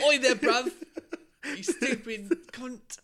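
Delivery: loud, high hammed-up British accent; mock London yell, slowing into laughter. Mimicking the singer's speaking accent, rowdy British. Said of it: "[name] and her accent".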